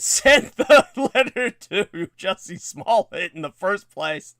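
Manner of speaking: talking through real laughter; helpless laughter breaking into the sentence; laughing. Real, not acted. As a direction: crack up while getting the words out. Laughs while relaying listener's joke.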